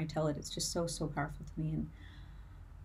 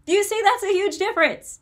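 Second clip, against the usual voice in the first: much higher and louder, driving it home; urgent, raised exclamation; emphatic shout; 13 dB louder than usual. Real, not acted. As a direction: shout the point at the audience. Hammering home the point to viewers.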